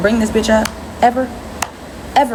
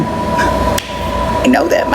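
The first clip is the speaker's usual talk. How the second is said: whisper; 10 semitones lower, much slower than usual. Pretend. Slow whispered repeat.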